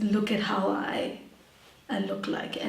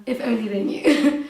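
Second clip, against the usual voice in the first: louder, with a laugh breaking out at the end; laughing wryly; 6 dB louder than usual. Real, not acted. Guest hinting at her real life, laughing wryly. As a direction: joke knowingly through a laugh. Wry joke about her true self.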